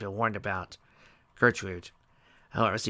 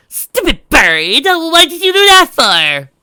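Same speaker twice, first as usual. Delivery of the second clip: very loud, high, slow shout; furious yell; 16 dB louder, much slower than usual. A narrator's reading. Trixie yelling at the hen that pecked her, loudly. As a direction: scream at the bird. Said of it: "Trixie gave a snarl".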